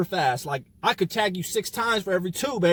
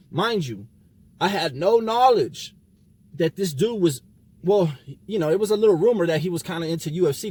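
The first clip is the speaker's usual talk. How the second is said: ordinary talk, nothing marked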